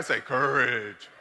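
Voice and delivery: everybody say courage pitched low; deep voice. Shown as a demonstration. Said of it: "You gotta say it all deep like that".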